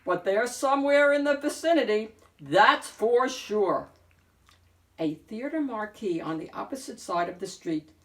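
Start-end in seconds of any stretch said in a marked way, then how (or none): 0.0-3.9 eager wolf. A narrator's reading. Wolf sniffing for pigs.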